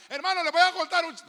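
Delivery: loud, high, hurried mock announcement; shouting gossip. Shown as a demonstration. Preacher showing how nobody gossips, shouting it. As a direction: blurt out gossip at full volume. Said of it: "Uno no grita".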